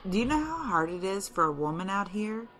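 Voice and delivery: ordinary talk, nothing marked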